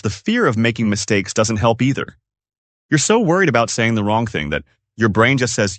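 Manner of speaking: usual speaking voice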